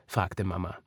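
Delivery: plain reading voice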